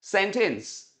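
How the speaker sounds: सेंटेंस repeated as SEN-tens; correct pronunciation. Shown as a demonstration. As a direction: drill सेंटेंस with an 'e' first vowel. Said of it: "इसको बोलिए पहले सेन". (सेंटेंस का सही उच्चारण, 'सेन-टेंस')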